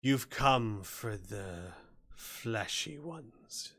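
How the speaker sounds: slow, drawn-out monster voice; booming hobgoblin. Acted. Hobgoblin questioning the intruder about prisoners, slow and booming. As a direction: stretch the words like a menacing champion. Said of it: "you hear in a loud, booming voice".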